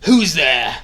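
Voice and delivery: angry shout. Acted. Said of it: "he shouted angrily".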